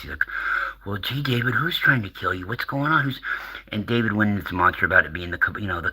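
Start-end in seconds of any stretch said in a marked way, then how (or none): 0.9-3.2 high-pitched clueless host; George Norrie. Retold or imitated. Radio host questioning a panicked caller, mock idiot voice. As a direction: play the gee-whiz dumb guy, voice swinging up. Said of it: "that, that, that idiot is".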